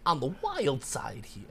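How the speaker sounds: odd swoops between long words; understated movie-star cadence; Christopher Walken. Shown as a demonstration. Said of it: "It's a horrible Christopher Walken".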